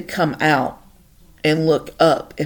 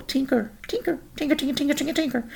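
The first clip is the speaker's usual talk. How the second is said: calling the dog; 11 semitones higher, 6 dB quieter, much faster than usual. Retold or imitated. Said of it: "I'll yell".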